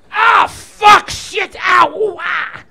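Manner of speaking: high, loud yelps of sudden pain. Acted. Blind fella jabbed by a needle, yelping high and loud. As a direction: shriek like you just got pricked. Pricked himself, yelling in pain.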